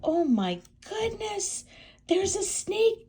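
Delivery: high, slow startled gasp. Acted. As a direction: shriek it in alarm. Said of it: "gasped Hilda".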